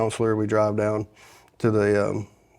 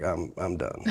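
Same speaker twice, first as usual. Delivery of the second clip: low and soft with a rueful laugh; quiet defeat; 5 semitones lower, 9 dB quieter, slower than usual. Retold or imitated. To the counselor after the skip, a low rueful laugh. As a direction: say it like you've given up, half laughing. Name skipped, expects jail.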